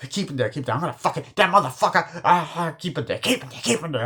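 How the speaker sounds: high, building mock fury; retold enraged threat. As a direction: act out straining to get at him, voice climbing. Acted enraged threat while restrained.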